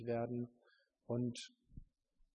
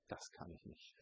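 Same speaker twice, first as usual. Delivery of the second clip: whispered doubt; 16 dB quieter than usual. Retold or imitated. Retold doubting prayer.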